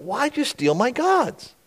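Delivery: high-pitched mock indignation; ticked off. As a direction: protest with a touch of outrage. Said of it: "Now he's really ticked about".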